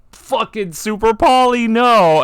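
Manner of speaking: loud, high wail of dismay; loud high "noooo" of a doomed player; dismayed outcry. Acted. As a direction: cry out at the awful draw, drawn out. Acting out a bad draw.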